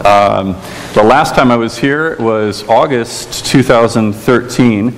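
ordinary talk

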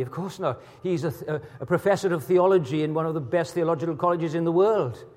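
usual speaking voice

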